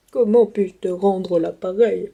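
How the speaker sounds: put-on low, slow old man voice; put-on old man, deep and drawn out; grandpa voice. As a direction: play a grateful grandpa, low and unhurried. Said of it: "toutes les voix ... pour les papis".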